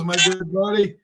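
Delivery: usual speaking voice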